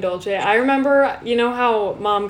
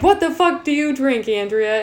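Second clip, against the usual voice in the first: higher-pitched mock outrage; comically disgusted, pitched up at the start; incredulous retold reaction; 5 semitones higher, 4 dB louder than usual. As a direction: imitate a friend's appalled demand. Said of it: "the way she says it is just so funny".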